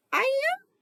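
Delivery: squeal. Acted. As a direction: squeal in disbelief. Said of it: "I squealed".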